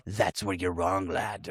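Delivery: low, put-on tough-guy voice; Knox's bad acting. Cutting off the guy talking, imitated bad acting. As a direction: imitate a hammy movie villain, pitched low. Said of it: "the real worst of his acting".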